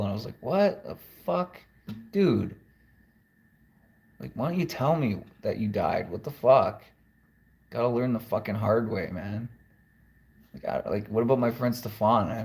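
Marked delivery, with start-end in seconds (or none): 0.4-6.8 blurting out stunned disbelief at a friend's death; shocked outburst. Retold or imitated. Retold reaction to memorial post.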